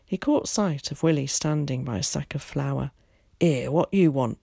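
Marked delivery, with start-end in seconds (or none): 3.4-4.4 mildly angry challenge; a touch of gruff shopkeeper's anger. A narrator's reading. Shopkeeper spots Willie by the flour sack, angry. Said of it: "he cried angrily".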